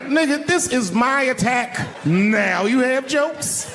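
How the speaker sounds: high, indignant outrage, slowed on the end; furious inner rant. Retold or imitated. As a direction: vent at the interloper. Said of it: "I was fucking furious".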